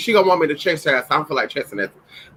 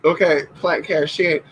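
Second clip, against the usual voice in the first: ordinary delivery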